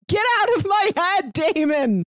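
normal talking voice

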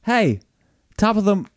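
put-on high, loud hammy Irish accent; overplayed Irish brogue, cut off midway; clumsy attempt at an Irish accent; mock Irish greeting. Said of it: "no, that's Australian".

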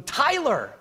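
high, loud call of a name; mock crying out. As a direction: cry out the name as the man at his feet would. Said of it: "him falling at my feet and going".

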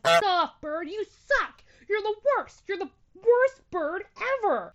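high, loud angry yell at the bird; reenacted yelling. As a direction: scream it up at the bird in the nest. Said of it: "I start yelling at it".

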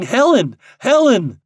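high, loud, anguished call; rising urgency on the repeated name. A narrator's reading. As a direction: call to someone who lies unconscious.

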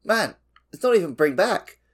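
ordinary talk, nothing marked

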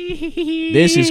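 sudden squeaky high elf pitch, slowed. Real, not acted. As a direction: voice jumps way up like an elf. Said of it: "[name] sounded like an elf".

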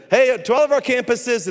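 high, loud camera shout-out; calling out. Retold or imitated. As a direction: hail every campus through the camera.

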